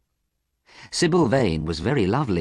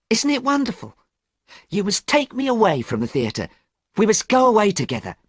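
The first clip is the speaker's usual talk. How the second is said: put-on light, high girlish voice full of joy; lightly voiced young woman in love, excited; gushing young actress, pitched high; happy young actress; 10 semitones higher than usual. A narrator's reading. Sybil voiced in story.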